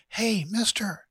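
high, lilting call for attention; put-on female voice, hailing someone; feminine frog voice. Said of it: "It was a feminine voice".

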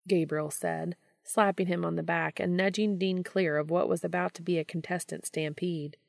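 ordinary delivery, nothing marked

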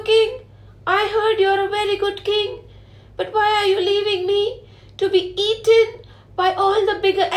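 high, small pleading fish voice; tiny fish voice pleading with the king; tiny little fish voice. Acted. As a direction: squeak it up like a tiny fish begging. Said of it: "with a tiny little voice, like a tiny little fish voice".

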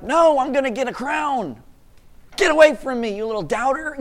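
high, loud playful shout of get away; mock yell at doubter. Believer yelling at a doubter, playful loud shout. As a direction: shoo the doubter off with a half-joking yell. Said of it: "ran away yelling".

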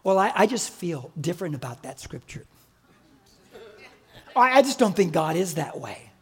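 low, hedging churchgoer; a hint of a hesitant believer. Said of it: "You know, the Christians".

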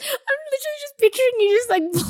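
laughing. Real, not acted. Teasing, picturing him playing.

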